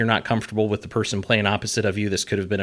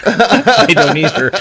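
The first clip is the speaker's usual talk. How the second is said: real laughter breaking into the words; louder, laughing through the admission; laughing agreement; 13 semitones higher, 12 dB louder, slower than usual. Real, not acted. Director agreeing with host about sound designer, laughing.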